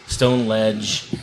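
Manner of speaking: ordinary talk, nothing marked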